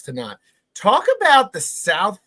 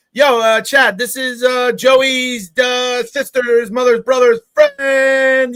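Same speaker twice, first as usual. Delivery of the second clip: louder, half-shouted South Philly call; imitating Gianna; 6 semitones higher, 5 dB louder, slower than usual. Gianna calling Chad about relatives, mock loud. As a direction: rattle off the relatives, slowing down.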